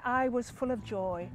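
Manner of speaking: soft, slow relish; savoured joy. Acted. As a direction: linger on it quietly, deeply satisfied. Husband savours his joy.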